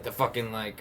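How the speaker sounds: ordinary talk, nothing marked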